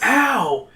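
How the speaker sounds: high yelp of pain; pained exclamation. A narrator's reading. Stone digs into your skin, high yelp. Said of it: "you exclaim".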